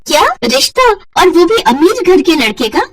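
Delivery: sharp high gasp of disbelief; shocked. Acted. Sister-in-law hearing the rich match, stunned and high.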